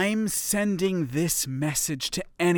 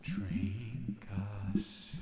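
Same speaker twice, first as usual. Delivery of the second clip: eerie whisper; 6 semitones lower, 14 dB quieter than usual. Acted. Eerie voice tempting astronaut.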